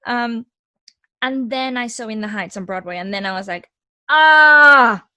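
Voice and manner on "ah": loud, high cry of sudden realization; big dramatized "aha" outburst, fading; acted-out revelation. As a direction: belt it out like an epiphany, then ease down. Acts out sudden realization at Broadway show.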